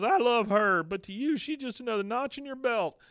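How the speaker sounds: high, loud mock heartbreak; hammed-up jealous protest; wounded outburst. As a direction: protest as the wronged lover. Acting out Bill's jealous outburst.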